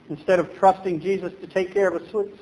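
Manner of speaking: normal talking voice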